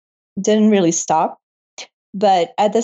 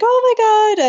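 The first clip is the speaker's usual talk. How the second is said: much higher, bright, delighted squeal; sweet excited gasp; 14 semitones higher than usual. Retold or imitated. Receptionist reacting to the news, sweet high delight. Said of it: "cute and sweet and was like".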